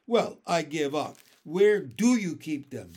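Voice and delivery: loud, emphatic question; an impatient boy's loud plea; exasperated. A narrator's reading. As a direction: give up and demand the answer. Boy gives up guessing, demands answer.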